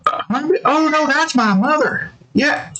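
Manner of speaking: put-on high girlish reply; daughter's voice. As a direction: correct him politely.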